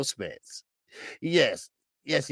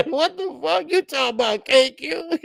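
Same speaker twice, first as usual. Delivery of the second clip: mock shocked friends; 12 semitones higher, 4 dB louder than usual.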